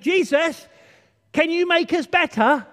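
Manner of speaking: high, loud call for help; shouted plea. A narrator's reading. Lepers calling out to Jesus, loud shouted plea. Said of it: "And they shouted".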